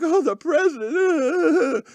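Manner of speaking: high, slow blubbering wail; mock crybaby. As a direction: whine it out as a mocking fake sob. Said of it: "some crybaby on there is like".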